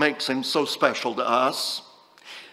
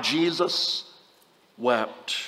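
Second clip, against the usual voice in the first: softer, lower, lingering on each word; hushed and solemn; 6 semitones lower, 6 dB quieter, much slower than usual. Acted. Preacher reading the verse to the congregation, hushed and solemn. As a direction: let the two words sink in reverently.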